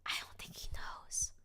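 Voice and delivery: breathy secret whisper. Acted. Dinosaur reassures herself, whispering. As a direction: hush it like a secret.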